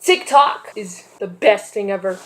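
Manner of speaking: loud, fast, hyped presenter; vlogger intro. Acted. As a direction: pump up the audience.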